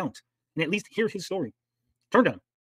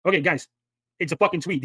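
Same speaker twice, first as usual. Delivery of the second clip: usual speaking voice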